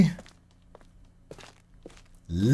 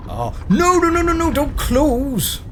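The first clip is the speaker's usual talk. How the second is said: high, loud panicked pleading; frantic plea as the door shuts; 4 dB louder than usual. Real, not acted. Door closing on him.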